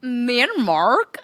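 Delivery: high, slow, drawn-out impression; exaggerated high, dragging celebrity voice; Kristen Cavallari. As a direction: stretch it out like a reality-show star. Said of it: "Kristen Cavallari".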